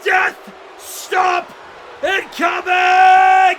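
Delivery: loud, urgent ringside calls; alarmed shouting. Real, not acted. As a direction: shout a sudden warning, stretching the last word. Commentator calls sudden attack live.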